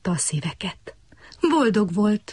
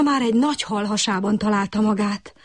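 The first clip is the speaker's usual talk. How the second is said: ordinary delivery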